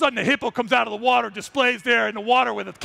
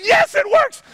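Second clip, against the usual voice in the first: high, loud burst of joy; excited cheer; 7 dB louder, slower than usual. Real, not acted. The remote finally works, loud high cheer. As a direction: shout it out, finally relieved.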